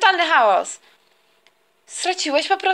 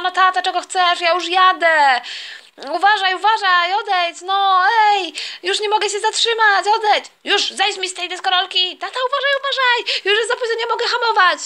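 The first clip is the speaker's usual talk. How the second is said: louder, rising to a high panicky yell; panicked warning; 3 semitones higher, 4 dB louder than usual. Acted. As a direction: warn him off as you lose control. Son crashes skateboard into dad.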